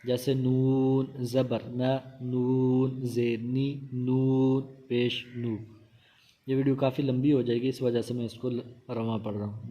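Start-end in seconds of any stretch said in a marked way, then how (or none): 0.3-5.6 slow, even letter-by-letter recitation of نون with each vowel; slow spelling-out. Shown as a demonstration. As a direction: stretch نون, then name the vowel and join the syllable. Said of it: "ہجوں کے ساتھ ہی".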